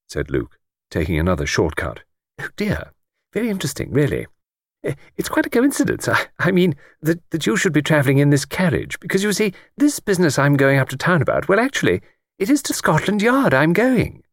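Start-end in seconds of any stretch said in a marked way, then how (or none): 2.4-14.2 put-on high, eager elderly lady voice; chatty old lady. Old lady on the train chatting to Luke, high elderly voice. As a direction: play a talkative old lady delighted by a stranger.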